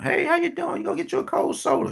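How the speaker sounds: high, bright mock greeting voice; imitating mother. Mother greeting people from her truck.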